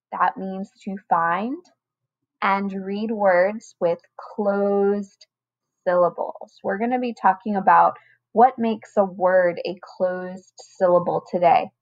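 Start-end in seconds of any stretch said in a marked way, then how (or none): 2.4-6.6 slow teacher read. Shown as a demonstration. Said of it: "teacher slows key phrase".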